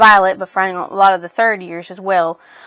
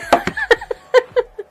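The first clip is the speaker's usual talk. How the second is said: sudden high-pitched disbelieving laugh. Real, not acted. Reacting to hundreds more pages.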